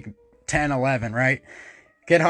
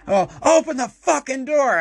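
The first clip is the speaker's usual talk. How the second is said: angry demand; 7 semitones higher than usual. Retold or imitated. Locked out, furious at brothers.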